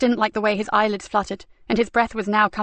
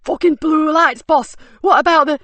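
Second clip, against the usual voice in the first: panicked shout; 7 semitones higher, 7 dB louder than usual. Acted. Panicking thug.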